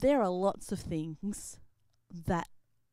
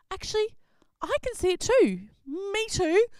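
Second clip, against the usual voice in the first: excited disciples; 12 semitones higher, 8 dB louder than usual. Acted. Disciples see the ghost.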